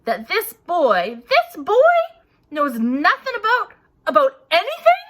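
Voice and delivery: giant's outrage building at the cowering Dursleys; mounting roar. A narrator's reading. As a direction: build until you explode on the second boy.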